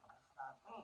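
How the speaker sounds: usual speaking voice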